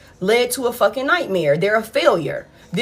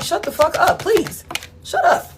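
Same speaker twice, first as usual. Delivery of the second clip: mock exasperation; 7 semitones higher than usual. Reader mocks annoying person.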